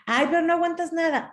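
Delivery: high, quick mock complaint; dismissive protest. Friend protesting, brushing off hurt.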